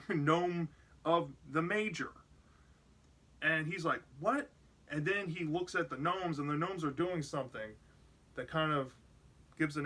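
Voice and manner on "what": retold high-pitched puzzled "what"; quick, higher, mildly startled question; taken aback. Joe spots the strange gnome of the Major, mock surprised.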